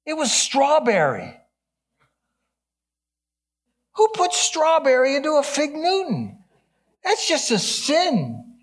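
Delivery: high-pitched, hammed-up indignation; mock outrage. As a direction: play scandalized, voice shooting way up. Comic outrage at strawberry Fig Newton.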